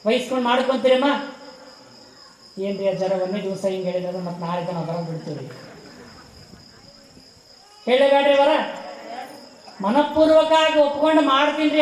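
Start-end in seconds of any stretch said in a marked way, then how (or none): 2.6-5.5 imitating reluctant devotees, low and soft; low grumbling aside. Preacher mimicking devotees objecting to the swami, in a low grumble. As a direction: voice a hushed, lower-pitched mock objection.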